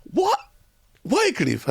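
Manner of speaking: surprised "What? Wycliffe?" pitched high; retold surprise. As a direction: echo the name in mock surprise. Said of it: "And he goes".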